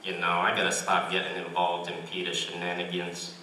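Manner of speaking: a hint of a mellow cartoon dad; Cleveland Brown. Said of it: "The first one is Cleveland Brown".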